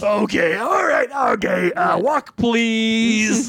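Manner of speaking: shouted okays and please; shouting. Acted. Vice principal leaving.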